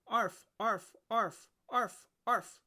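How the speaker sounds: high yappy puppy barks; puppy barking. A narrator's reading. Storyteller barks as the eager firehouse puppy. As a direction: yip like an excited little puppy.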